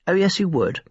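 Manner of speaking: high, sharp contradiction; thin girl's catty comeback; tart retort. A narrator's reading. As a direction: cut her down with a quick no.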